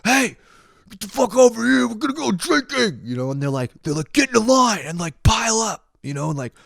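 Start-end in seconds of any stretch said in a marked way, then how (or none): 0.0-3.0 very high, strained holler; mock yelling. Engineering students in jumpsuits to freshmen, mock yelling. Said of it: "they're, like, yelling at you". 4.1-5.8 high-pitched shouted orders; mock yelling. Jumpsuit leaders lining up freshmen, mock yelling. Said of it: "they're, like, yelling at you".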